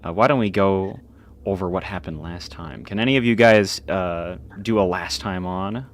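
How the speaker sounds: ordinary talk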